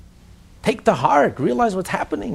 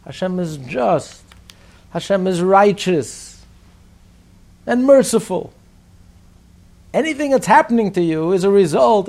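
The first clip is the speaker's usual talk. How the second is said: slow, each line weighed, swelling louder; slow solemn proclamation; 7 dB louder, slower than usual. Retold or imitated. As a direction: proclaim it slowly, building in force. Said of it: "he says".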